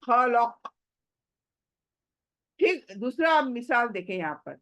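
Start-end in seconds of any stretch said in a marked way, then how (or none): 0.0-0.7 खलक closed with qaf shaken in the throat; throat qaf stop. Shown as a demonstration. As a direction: drop the vowel and bounce the qaf in खलक. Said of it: "काफ को हलक में हिला रहे हो".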